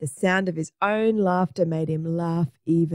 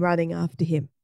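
usual speaking voice

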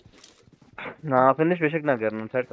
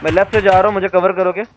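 higher, louder urgent call; cover me shout; 6 semitones higher, 6 dB louder than usual. Real, not acted. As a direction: shout for cover mid-fight. Calling for cover in fight.

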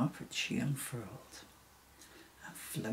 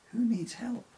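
high searching call, projected; 10 semitones higher, 4 dB louder than usual. Acted. Emily repeats her call to the hidden stranger, calling out. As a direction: call out higher to find the voice. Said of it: "she calls out".

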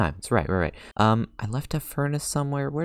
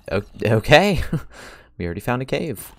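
usual speaking voice

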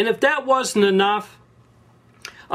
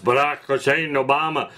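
announcer voice; 5 semitones lower than usual. Retold or imitated. Mimicking the TV announcer naming the prize winner, low and formal. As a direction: read the name like a solemn news announcer. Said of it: "when the guy announced".

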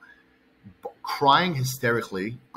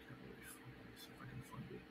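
a barely voiced whisper while searching; real whisper, scrolling through the phone; whispering to self; 9 semitones lower, 29 dB quieter than usual. Real, not acted. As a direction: mutter it under your breath, distracted.

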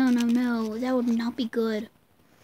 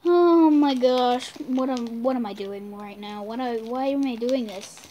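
eating sour candy; 3 semitones lower than usual. Real, not acted.

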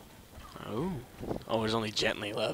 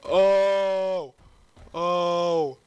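excited cry; 6 semitones higher, 12 dB louder, much slower than usual. Real, not acted.

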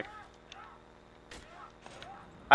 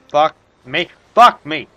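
startled shout; 2 semitones higher, 8 dB louder than usual. Real, not acted. Monster attacking.

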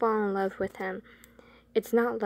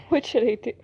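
laughing through line; 5 dB louder, faster than usual. Acted. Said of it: "really fucking dumb voices".

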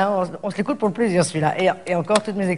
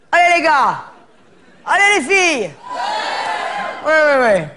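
mock team cheer; 5 semitones higher, slower than usual. Cheering herself on.

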